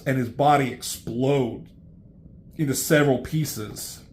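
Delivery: ordinary delivery, nothing marked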